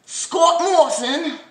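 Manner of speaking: slow, high, loud name call; mock announcer. Vlogger mocking Scott Morrison, grand announcer voice.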